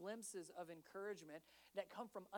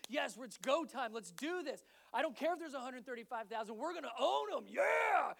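building to a shout, higher and louder; rally the troops, end in a yell; pumped battle cry; 4 semitones higher, 8 dB louder than usual. Acted. Soldiers pumped for battle, building to a shouted yeah.

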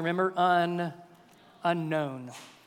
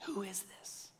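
hushed, intimate whisper; 17 dB quieter than usual. Real, not acted. Preacher whispering the question to the congregation. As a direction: drop to a whisper to draw them in.

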